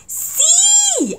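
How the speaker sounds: high, loud insistent "Sí"; emphatic Chilean "Sí"; insistent yes. Shown as a demonstration. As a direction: insist sharply, pitch jumping up. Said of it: "hazme caso".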